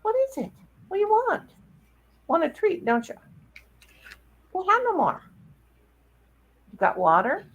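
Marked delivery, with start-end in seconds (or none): 0.1-5.2 much higher cooing voice. Real, not acted. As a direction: coo questions at the pet. Baby talk to dog.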